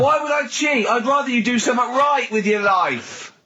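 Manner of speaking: half-joking outraged shout at the producer; mock outrage. [name] teasing Carl's cheating claim.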